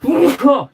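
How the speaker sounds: loud sudden sneeze; sneezing. Real, not acted. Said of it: "sorry, I'm gonna sneeze".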